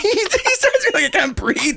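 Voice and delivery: high and slow, laughing as he quotes; giggling through the complaint; laughing mock quote. Real, not acted. Laughing retelling.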